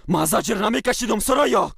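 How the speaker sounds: furious shouted denial; angry shout. Acted. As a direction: hurl it back at her, loudest on the name. Husband and wife quarrel.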